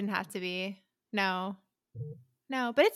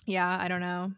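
usual speaking voice

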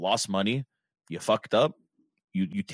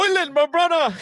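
mock fan greeting; 9 dB louder, slower than usual. Fan greeting lookalike player.

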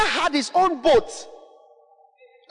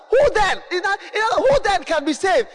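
astonished disciples; 7 semitones higher, faster than usual. Retold or imitated. Disciples asking Jesus in dismay, high dismayed cry. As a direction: cry out in disbelief as the stunned disciples. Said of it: "Saying among themselves".

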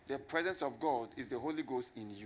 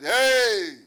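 sudden, much louder, higher "Hey!"; mock shout; 6 semitones higher, 12 dB louder than usual. Pastor mocking a booming voice from the wall, shouting. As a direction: bark it out like a voice from the wall. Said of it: "the voice said".

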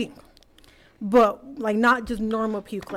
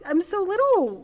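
put-on much higher, indignant kid voice; exasperated little kid; 9 semitones higher than usual. Seven-year-old protesting to the nurses, high and indignant. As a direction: play a tiny kid outraged at the needle. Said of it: "I'm like".

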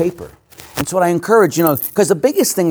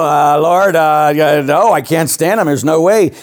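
higher, louder stubborn refusal; loud retold protest; 4 semitones higher, 6 dB louder than usual. Priest arguing with the Lord in prayer, louder and higher. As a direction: push back at God, half laughing.

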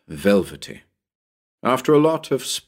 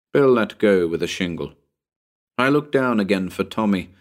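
usual speaking voice

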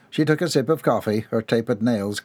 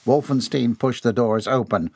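ordinary delivery

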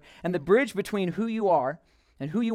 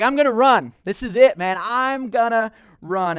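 higher, louder hype; pumped-up vow; 4 semitones higher, 5 dB louder than usual. Retold or imitated. College student hypes himself up to run, pumped. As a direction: psych yourself up out loud. Pumped self-declaration before running.

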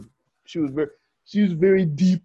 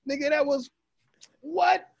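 mock outrage; 11 semitones higher than usual. Mock outrage at old news.

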